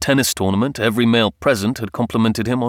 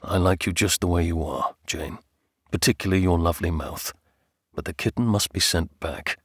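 soft, slightly lowered husband's voice; tender husband; 4 semitones lower, 4 dB quieter than usual. Acted. Husband compliments wife but refuses kitten, tender. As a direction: speak softly and fondly, then firmly.

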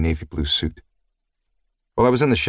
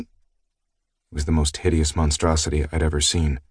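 plain reading voice

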